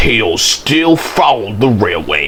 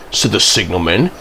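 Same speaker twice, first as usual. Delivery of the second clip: plain reading voice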